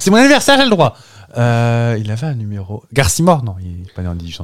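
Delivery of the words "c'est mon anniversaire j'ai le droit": much higher, cheeky excuse; mock-indignant birthday excuse, high; playful protest. Real, not acted. Joking excuse after a pun.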